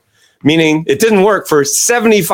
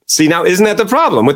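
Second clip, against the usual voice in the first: ordinary talk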